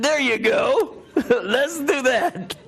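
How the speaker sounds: high, slow, laughing through every word; laughing reply. Retold or imitated. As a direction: chuckle it out like you've been caught. Agreeing to tell the whole story.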